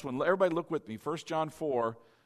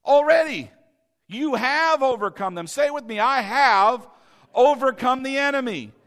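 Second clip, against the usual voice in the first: preaching at full force; 7 semitones higher, 9 dB louder than usual. Acted. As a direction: pound the conviction into the congregation. Preacher builds again.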